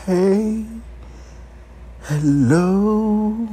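put-on high, sing-song baby talk greeting. Cooing to a dick like a baby. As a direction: coo like greeting an infant. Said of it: "Talking to the motherfucking dick like it's a motherfucking baby".

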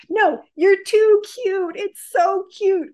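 high, slow cooing at something adorable; slow baby-talk coo; cooing girl. Retold or imitated. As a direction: coo like a smitten schoolgirl. Classmate cooing at the panda.

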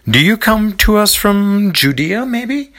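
high, slow, sing-song merchant voice; eager vendor. A narrator's reading. Vendor sweet-talking three travellers at the market, high and drawn out. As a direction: coax the strangers like a market hawker.

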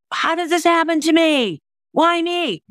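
high, plaintive mock self-pity; mock victim whine. Imitating a self-pitying victim crying out, high and mock-plaintive. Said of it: "it doesn't make it like".

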